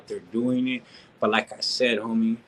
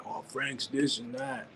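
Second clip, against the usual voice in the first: softer, slow mock of a hater; mocking critics; 10 dB quieter, slower than usual. Imitating fools talking trash about him, softer slow mockery. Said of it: "talk shit about me and say".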